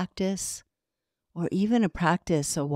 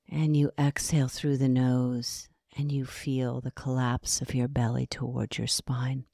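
guided meditation. Acted. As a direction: ease the listener into breathing, unhurried.

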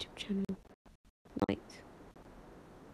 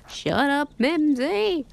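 mock yell at Mimsy, pitched up; imitating Nathan; 6 semitones higher, 5 dB louder, slower than usual. Streamer recalls a classmate yelling at Mimsy, mock yell. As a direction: shout it like a bratty kid. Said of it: "He's like".

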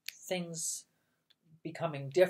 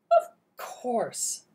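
bossy aunt; 8 semitones higher, 7 dB louder than usual. Acted. Bossy aunt answers firmly.

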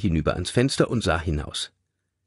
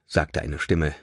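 ordinary delivery, nothing marked

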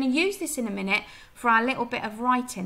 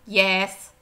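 short, lower farmer's grunt of a word; gruff one word; 3 semitones lower, 8 dB louder than usual. Acted. Teacher voicing Farmer Hoggett's single word, low and terse. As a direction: say it like a man who never wastes words.

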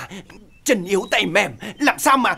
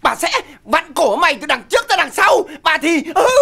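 loud, straining mock threats; imitated wife's threats; 3 semitones higher, 6 dB louder than usual. Husband mimics his wife threatening him mid-contraction, loud and straining. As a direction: curse like a woman in labour, grunting at the end. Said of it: "À, nó chửi là".

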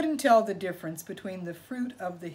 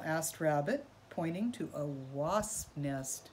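ordinary delivery, nothing marked

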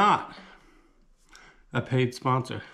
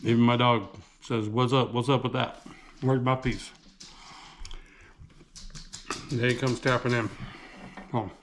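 talking while chewing; muffled through food; mouth full; 7 semitones lower, 5 dB quieter than usual. Real, not acted. Seen 2: Bites at -4, cheeks full, pizza in hand while talking.

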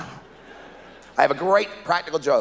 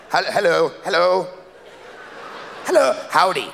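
rehearsing sober hello; 3 semitones higher than usual. Shown as a demonstration. Drunk man rehearsing a sober greeting outside his door. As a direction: try to sound sober at the door. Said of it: "Don't say howdy, idiot".

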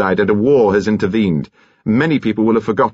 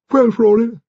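high-pitched imperious shout; Lady's high-pitched shouted greeting; 3 dB louder than usual. A narrator's reading. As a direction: call out sharply in a high old lady's voice. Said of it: "Lady Lacklandar shouted".

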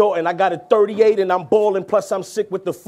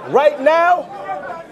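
high, raised restart over the roar; calling out; 10 semitones higher, much slower than usual. Real, not acted. Rapper restarting over a noisy crowd, calling out. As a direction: throw it out high above the crowd.

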